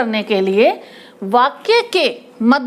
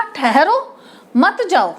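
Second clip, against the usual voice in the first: slow "ठहरो", short break, then "मत जाओ" together; pause after ठहरो; slower than usual. Shown as a demonstration. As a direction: keep "मत" with "जाओ", apart from "ठहरो". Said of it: "बताने का तरीका देखो".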